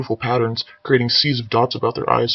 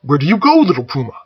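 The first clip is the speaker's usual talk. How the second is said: higher, louder eager call; eager booming call; eager warrior; 4 semitones higher, 6 dB louder than usual. Acted. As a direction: chase after the boy eagerly. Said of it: "follow suit like an eager dog".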